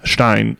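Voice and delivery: "Stein" said shtine, with the I sounded as in "fine"; shtine, teacher's demo; German Stein. Shown as a demonstration. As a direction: model "Stein" as shtine for a learner. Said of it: "you just pronounce the I".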